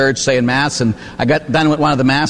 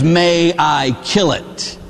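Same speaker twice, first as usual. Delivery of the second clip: each word spaced out deliberately; slow, insistent question; much slower than usual. Retold or imitated. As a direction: ask it very slowly, pressing for an answer. Angel insists a third time.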